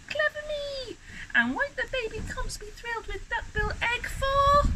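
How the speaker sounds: very high-pitched tiny creature voice; self-pleased little dinosaur. A narrator's reading. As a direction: congratulate yourself in a squeaky little voice. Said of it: "ran with it and muttered".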